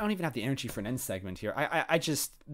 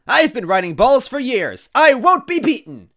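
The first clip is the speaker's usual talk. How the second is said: high, loud character shout; crying out; 11 semitones higher, 13 dB louder, slower than usual. Acted. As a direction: cry it out defiantly. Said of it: "Wendy cried out".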